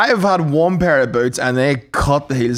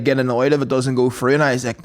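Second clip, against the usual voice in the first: ordinary delivery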